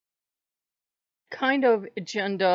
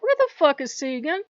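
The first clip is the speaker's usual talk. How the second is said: indignant; 8 semitones higher, 5 dB louder than usual. Retold or imitated. Indignant retold reaction.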